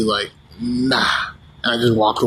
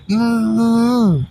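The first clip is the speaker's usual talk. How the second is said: suggestive hum; 10 semitones higher than usual. Retold or imitated. Sucking sausage suggestively.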